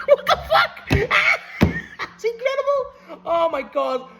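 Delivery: high, loud squealing laughter through the words; overwhelmed, words breaking into real laughter; laughing disbelief. Real, not acted. Fan reacting to Kenny facing Zack Sabre Junior, laughing disbelief. Thrilled reaction to announced match.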